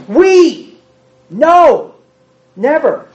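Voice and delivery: loud, high "We! No!"; emphatic shout. Acted. Preacher refuting a mock argument, loud exclamation. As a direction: cry out the rebuttal.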